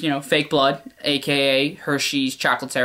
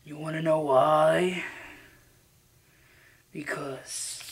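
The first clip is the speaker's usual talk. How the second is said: slow, softer sinister taunt; menacing villain; 5 dB quieter, much slower than usual. Acted. Villain taunts the terrified victim, slow and sinister. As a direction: lean in and taunt.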